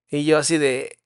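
normal talking voice

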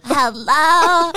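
high, loud pop star hello. Retold or imitated. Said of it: "that's pre-conservatorship Brittany".

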